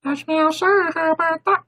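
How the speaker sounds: very high squeaky voice; squeaky cartoon voice. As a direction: pitch it up like a cartoon. Squeaky put-on voice.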